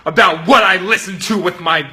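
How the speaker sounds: loud, high indignant outburst; getting mad. Acted. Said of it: "I just start getting mad".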